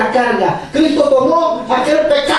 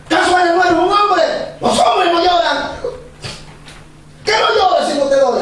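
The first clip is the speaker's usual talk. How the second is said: imitating his scolding father, a higher, harsh reproach; stern father scolding; 5 semitones higher than usual. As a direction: scold the crying boy like a strict dad. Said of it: "Así me hablaba mi papá". (padre severo regañando, imitado)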